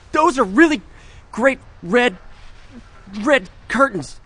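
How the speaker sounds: high, halting, pointed praise; strained high-pitched hinting; desperate hint. Acted. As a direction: push the code word while faking casual talk. Desperately hinting a code word.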